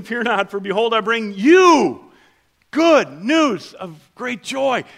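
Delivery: slow, loud, high proclamation; proclaiming angel. Acted. Angel proclaims to shepherds, slow and loud. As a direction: announce it like a herald angel.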